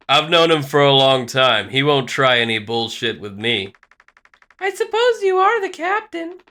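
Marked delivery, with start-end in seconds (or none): 4.6-6.4 high feminine character voice; high female voice. Acted. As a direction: play the evaluator up high. Female evaluator voice.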